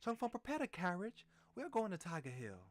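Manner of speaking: high, loud urgent orders; alarmed shout. Acted. King alarmed, giving orders.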